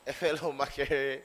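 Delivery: amused, chuckling through it; laughing. Real, not acted. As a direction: laugh out the words a fellow Makerere. Laughs on repeating the phrase.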